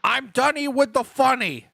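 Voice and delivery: high, drawn-out mock scream; slow, high-pitched play yell. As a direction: practise a scream at high pitch without full volume. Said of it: "Let's practice screaming".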